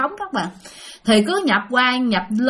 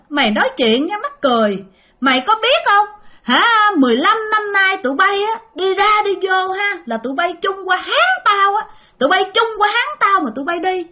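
possessed wife; 9 semitones higher than usual. Retold or imitated. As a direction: berate him like a jealous ghost. Possessed wife scolds husband.

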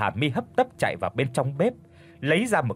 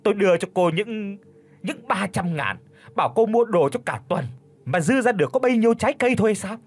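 put-on high, shrill old woman complaining; whining lament; 7 semitones higher, faster than usual. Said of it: "chép chép cái hàm răng vàng vọt rồi ca thán". (mẹ chồng ca thán, giọng chua cao)

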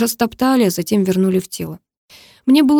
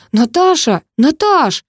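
higher-pitched call after someone; urgent calling out; 8 semitones higher, 4 dB louder, slower than usual. A narrator's reading. Groom calls after his fleeing bride, urgent and raised. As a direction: call out urgently, climbing on the second call.

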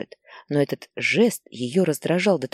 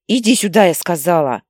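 angry shout; 2 semitones higher, 7 dB louder than usual. Acted. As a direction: bark the order across the yard. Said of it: "кричала Лариса". (мать громко кричит сыну, приказывая вернуться)

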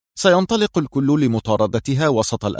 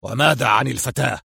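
understated angry demand; a touch of anger in the question. A narrator's reading. Boss demands news of the girl, mildly angry. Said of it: "وهو يقول في غضب".

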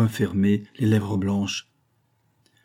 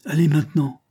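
higher and louder bitter cry; 7 semitones higher, 4 dB louder than usual. Acted. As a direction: fling it at her. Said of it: "s'écria-t-il". (s'écrier plus haut et plus fort)

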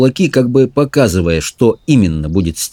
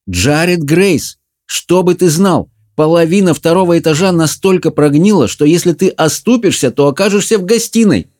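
stern scolding; 9 semitones higher than usual. A narrator's reading. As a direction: scold like an alarmed mother. Mother scolds son about the attic.

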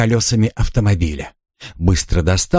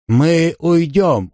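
slow, raised placating voice; slow deliberate; 8 semitones higher, much slower than usual. A narrator's reading. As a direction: stretch out the words to buy time. Bargaining with bandit.

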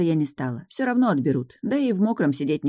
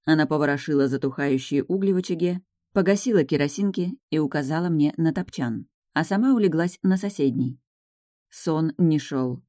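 usual speaking voice

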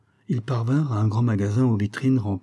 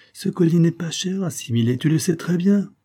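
high, louder indignant protest; embarrassed outcry; 9 semitones higher, 5 dB louder than usual. A narrator's reading. Wife defending the necklace's price to husband, embarrassed outcry. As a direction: argue back, mortified and insistent. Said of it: "s'écria Mister Wells, rougissant d'embarras".

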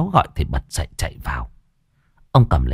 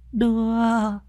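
put-on high, drawn-out frail old woman's voice; very slow, feeble plea pitched high; 12 semitones higher, much slower than usual. A narrator's reading. Old great-grandmother begs to be taken outside, feeble and drawn out. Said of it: "mà thều thào".

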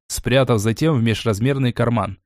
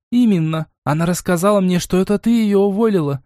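accusing exclamation; 7 semitones higher than usual. Acted. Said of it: "обвиняюще воскликнула Лайза".

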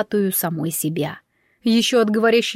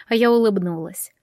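usual speaking voice

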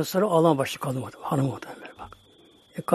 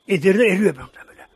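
ordinary delivery

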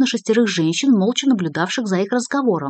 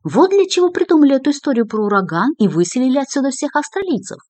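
high, louder indignant protest; raised voice; 8 semitones higher, 4 dB louder than usual. A narrator's reading. Journalist accuses organisers of inventing the hurricane, voice raised high. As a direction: burst out in pitched-up protest. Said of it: "повысил голос Вацлав".

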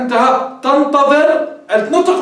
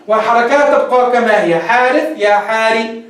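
ordinary talk, nothing marked

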